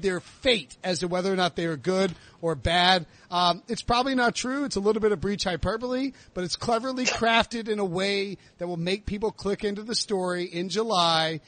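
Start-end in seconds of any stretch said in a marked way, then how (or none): none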